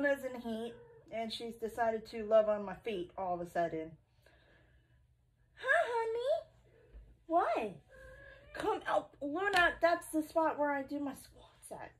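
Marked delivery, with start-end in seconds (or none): 5.5-9.7 high cooing pet talk. Real, not acted. Cooing to the cat.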